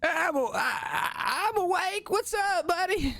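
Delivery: cheerful high kobold pal. Acted. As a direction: play a chirpy goblin-like kobold. GM voices kobold Dom.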